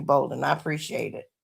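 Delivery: ordinary talk, nothing marked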